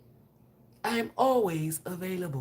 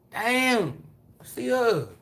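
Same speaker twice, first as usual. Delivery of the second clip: loud, high Memphis country holler; imitating loud Memphis street talk; gutter Memphis talk; 6 semitones higher, 8 dB louder, much slower than usual. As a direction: call out like the Memphis guys. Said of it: "That's how they be talking".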